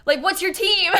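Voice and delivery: loud, high, eager fan voice; high, loud mock-excited waiter. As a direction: mimic an eager stranger gushing. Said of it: "They're like".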